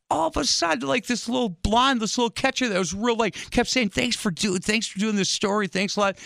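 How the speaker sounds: put-on high, sweet little girl voice; hammed-up little girl voice; mock little girl. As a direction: pipe up like a sugary young catcher. Retold girls mocking him.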